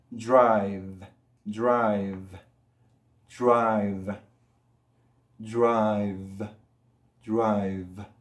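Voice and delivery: "drive" with a held, buzzing V; low, stretched "drive" with a voiced V; vibrating ending. Shown as a demonstration. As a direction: let the V vibrate at the end of "drive". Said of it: "Tenemos esa vibración".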